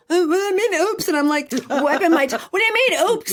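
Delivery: high, loud alarmed repeat of the question; panicked alarm. Retold or imitated. After the friend says oops, a high loud panicked demand. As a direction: squeal it in panic. Panicking about drops on tongue.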